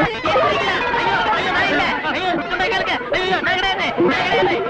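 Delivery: high, frantic cries of protest; panicked pleading. Acted. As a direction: plead desperately, rising to a cry. Pleads he is alive, not corpse.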